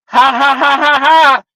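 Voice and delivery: a hammed-up imitation of the kid's laugh; imitated laughter. Grocery kid laughing at the customer's claim, mock laugh. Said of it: "Here we go with the laughter again".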